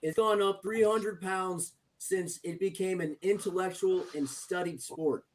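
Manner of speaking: very slow, fading guru talk; slow solemn lecture. Acted. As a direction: lay it down slow and soft.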